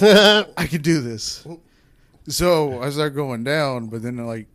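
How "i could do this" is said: slow, loud, cocky boast; mock confident. Telling a friend his bold plan, slow and cocky. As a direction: puff up with mock bravado. Bragging about porn know-how.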